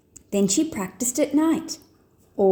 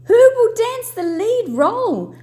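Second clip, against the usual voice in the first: higher, louder anxious call; high, raised worried cry; desperate cry; 12 semitones higher, 5 dB louder than usual. A narrator's reading. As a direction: call out for help in a panic. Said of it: "cried the ballet director".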